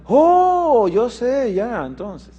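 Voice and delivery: a high, bright "Oh" of discovery that fades down; child's sudden realization. Retold or imitated. Child at school recognizing the word papá, high surprised "oh". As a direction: act out a child suddenly getting it.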